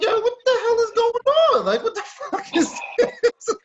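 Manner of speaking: quoting himself after a tackle in practice, shocked; retold shock. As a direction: relive being knocked down, baffled. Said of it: "I'm like".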